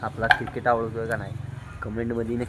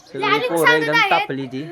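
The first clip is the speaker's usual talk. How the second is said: excited; 11 semitones higher, 4 dB louder than usual. Real, not acted. Excited about kids' cricket.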